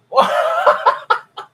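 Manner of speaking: airy squeaky burst of laughter; high wheezing laugh. Real, not acted. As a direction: cackle at something ridiculous. Laughing at absurd fan claim.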